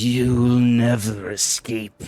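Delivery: menacing hag, drawn-out and forceful. Acted. Shapeshifted hag threatening to take Saskia's body, hag-like voice. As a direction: threaten like a hag, no sweet lilt. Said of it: "hag-like voice".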